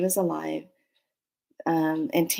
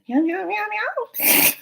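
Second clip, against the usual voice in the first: high cat-voice meowing, rising at the end; high meows; slower than usual. Retold or imitated. Playing the cat answering her, high meows.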